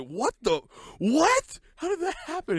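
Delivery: high shocked what, then how did that happen; baffled. Retold or imitated. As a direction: squeak out the confusion. Quoting a baffled hero.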